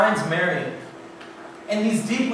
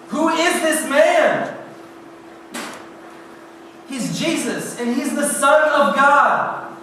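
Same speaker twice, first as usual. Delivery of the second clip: high, emphatic astonishment; awed excitement; 6 semitones higher than usual. Acted. Witness telling of Lazarus brought back to life, amazed. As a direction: proclaim it in amazement, voice raised high.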